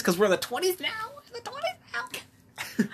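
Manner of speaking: high-pitched excited whoop, stretching out at the end; mock excited squeal. Mock excitement over the twenties.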